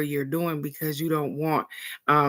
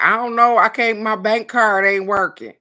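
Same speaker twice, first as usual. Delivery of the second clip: higher, louder whining excuse; whiny caller; 5 semitones higher, 7 dB louder than usual. Retold or imitated. Caller at 3:45 with a bank card excuse, mock whining. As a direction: whine like a helpless caller.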